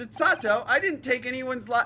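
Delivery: high, loud acted protest; hammed-up frantic denial; desperate denial. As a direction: plead innocence in a panic. Voices accused character denying a killing.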